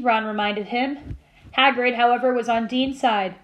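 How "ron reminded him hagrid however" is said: plain reading voice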